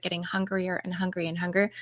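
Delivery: breathy whispered list; a half-whispered retelling, trailing off. Retold or imitated. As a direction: let it fade to a whisper. Tells of growing hunger.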